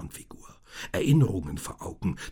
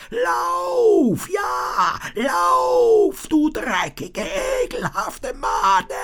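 much higher, louder shrieking cry; louder, high-pitched demonic shriek; screaming demon; 11 dB louder, much slower than usual. A narrator's reading. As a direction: spit the insult like a mad spirit.

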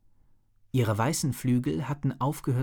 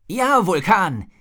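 slow, much higher and louder cheer; 8 semitones higher, 9 dB louder, slower than usual. A narrator's reading. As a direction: celebrate the rescue at full voice. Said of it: "jubelte Rory".

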